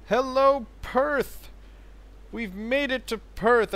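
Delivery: high, loud hello to the city; excited greeting. Real, not acted. Greeting the city after a long patrol, excited and loud. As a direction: call it out like you've finally arrived. Arriving at port, calling out.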